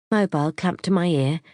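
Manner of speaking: ordinary delivery, nothing marked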